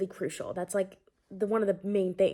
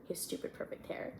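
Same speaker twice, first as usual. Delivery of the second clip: whispered aside; 12 dB quieter than usual. Acted. Narrator's exasperated aside while reading.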